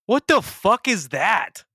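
high, slow, stunned "what is that"; shocked disbelief. Real, not acted. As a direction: draw it out in real shock. Friend sees the jacket.

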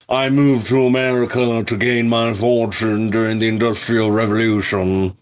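put-on a lightly done, jokey posh British accent; big teeth British voice. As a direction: ham up a stuffy British gent, only lightly. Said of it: "the big teeth British voice".

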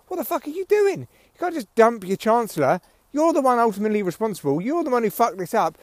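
incredulous public. Retold or imitated. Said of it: "People are gonna be like".

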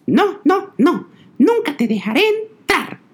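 the piglet refuses from behind the door; little pig voice. A narrator's reading. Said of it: "El cerdito de en medio le respondió".

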